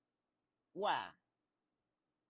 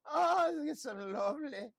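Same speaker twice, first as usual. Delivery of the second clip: high, loud gushing delight; Papa Tarly impression; 14 semitones higher, 9 dB louder than usual. Recapping the show, mimicking Papa Tarly's high delighted gush. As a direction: squeal it out like a giddy Samwell type. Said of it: "That's my Papa Tarly impression".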